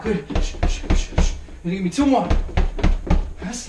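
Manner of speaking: ordinary talk, nothing marked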